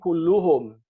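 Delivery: Kulluhum with u endings, stressed. Shown as a demonstration. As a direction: say Kulluhum with damma. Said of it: "he didn't say".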